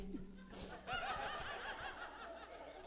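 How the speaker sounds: long breathy laugh in a high voice; hearty laugh. Real, not acted. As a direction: laugh at yourself. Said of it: "Can you tell I have ADHD".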